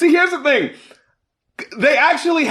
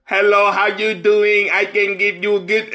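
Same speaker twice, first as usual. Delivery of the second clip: imitating the song; 2 semitones higher than usual. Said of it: "And then they hear".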